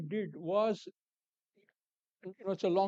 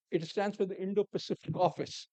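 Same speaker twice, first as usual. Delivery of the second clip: ordinary talk, nothing marked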